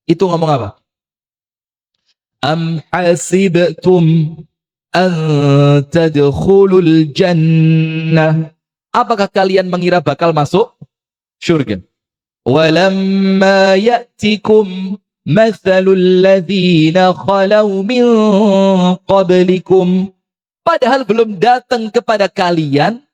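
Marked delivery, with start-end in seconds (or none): 2.4-8.6 slow, drawn-out chanted recitation; Quran recitation. Acted. Quran verse recited in sermon. 12.5-20.1 slow, drawn-out chanted recitation; Quran recitation. Acted. Quran verse recited in sermon.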